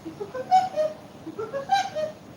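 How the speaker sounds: very high rat-a-tat cartoon cackle; cartoon woodpecker laugh, high; Woody Woodpecker laugh. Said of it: "That's my Woody Woodpecker impression".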